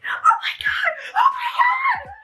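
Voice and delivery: very high, loud fangirl shriek; Cassandra's excited screaming. Retold or imitated. Imitating a girl whose question got picked, excited shrieking. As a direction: squeal it in overjoyed disbelief, laughing.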